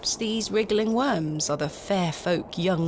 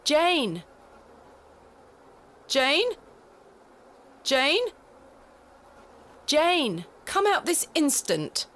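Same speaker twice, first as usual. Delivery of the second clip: high stern calling; 8 semitones higher, slower than usual. Acted. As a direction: call a hiding child sharply. Calling for hiding child.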